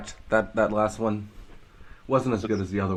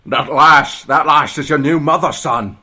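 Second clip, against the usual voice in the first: louder Scottish-tinged dad; louder mock Scottish father to son; 6 semitones higher, 9 dB louder than usual. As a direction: tell the son about his new mother, Scots dad. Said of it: "dad in Scottish accent".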